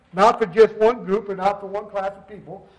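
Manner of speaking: ordinary talk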